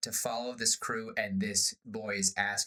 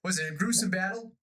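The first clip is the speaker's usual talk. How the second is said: put-on eager boy; 8 semitones higher, faster than usual. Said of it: "They're like".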